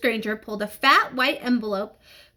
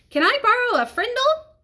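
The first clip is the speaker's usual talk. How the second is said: cheeky high ask; 12 semitones higher, 3 dB louder than usual. Acted. Kid mimicking a cheeky request.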